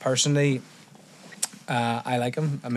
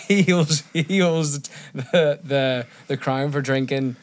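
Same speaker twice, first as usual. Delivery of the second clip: ordinary talk, nothing marked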